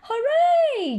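high, loud cheer. Acted. George cheering the rescued balloon. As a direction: shout it with joy. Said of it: "George cheers".

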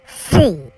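シー said loud and drawn out as the English "sea"; loud demo of シー; careful English pronunciation. Shown as a demonstration. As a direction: model シー like a teacher drilling a word. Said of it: "ここ発音大事なとこやで。".

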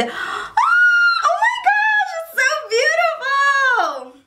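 gasp, then a very high, slow, near-tearful shriek; overwhelmed with joy at the sight; gasping excited shriek. Real, not acted. Vlogger gasping at her new glasses, shrieking with joy.

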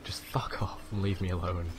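low, soft mock-emo grumble; emo sulk. Gamer playing an emo who wants solitude, sulky mutter. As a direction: sulk it under the breath. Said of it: "going for the emo phase now".

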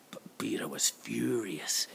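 hushed storytelling whisper. Acted. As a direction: whisper it like a secret. Narration drops to whisper.

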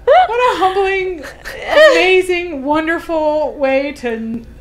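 high and slow through laughter; laughing. Retold or imitated. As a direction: say it while cracking up. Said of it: "and I thought".

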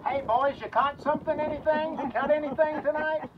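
high, loud, cheery heckle from the shore; joker on the shore. Retold or imitated. A joker on the shore needling the fishermen, loud and high. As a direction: call out like a know-it-all bystander. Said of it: "some joker on the shore saying".

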